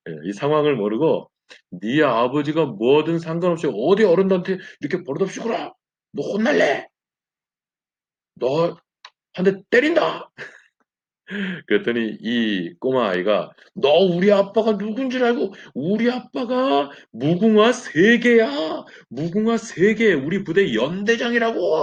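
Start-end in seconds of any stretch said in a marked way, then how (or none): 1.7-10.3 higher, firm telling-off; scolding recruit. Retold or imitated. Recruit warning the colonel's son, stern scolding. 13.7-21.8 put-on a spoiled boy shouting his dad's rank; bratty kid yelling. Colonel's son yelling at the recruit, bratty boast. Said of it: "소리를 딱 지릅니다".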